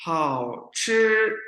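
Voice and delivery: हाओ ची drawn out very slowly, each syllable held; slow model pronunciation. Shown as a demonstration. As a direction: say हाओ ची slowly and clearly for the class. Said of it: "अगला word है".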